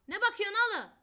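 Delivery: high, loud challenging yell; shouting. Real, not acted. Yelling at a friend who keeps staring in the game, loud. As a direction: bark it at him, what are you looking at.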